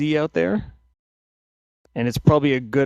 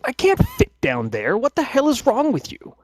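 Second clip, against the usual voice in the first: high, exasperated guard voice; annoyed guard; 11 semitones higher than usual. Acted. Crossbowman arguing with the guard, high and irritated. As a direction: snap back at the other guard. Second guard snapping back.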